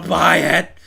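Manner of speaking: put-on a nagging parent ordering the child to buy; mock parent yell. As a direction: bark it at the kid like a pushy mom. Parent pushing purchase.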